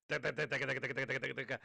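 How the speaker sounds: a punchy imitation of a machine gun firing; imitated machine gun. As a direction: rattle it off like gunfire. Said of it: "Machine gun sound".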